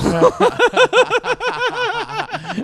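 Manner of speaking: shrieking laughter; a high helpless laugh; cracking up high. Real, not acted. Laughs at bloody apple.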